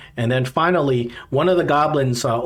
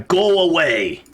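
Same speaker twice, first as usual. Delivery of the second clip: higher-pitched goblin shooing someone off; goblin reply; 4 semitones higher, slower than usual. Acted. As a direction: refuse through a door in a goblin voice. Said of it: "you get the same reply".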